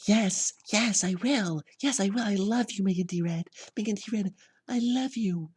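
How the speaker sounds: very high squealing falsetto, loud; mock excited girlfriend. Girlfriend accepting his proposal, squealing mock falsetto. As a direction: gush in a put-on high girly voice. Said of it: "And she'll say".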